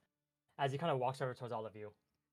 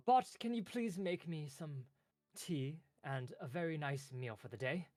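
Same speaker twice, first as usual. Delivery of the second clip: pleading clerk; 4 semitones higher than usual. Acted. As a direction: ask sweetly for a favour. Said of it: "he kinda walks over towards you".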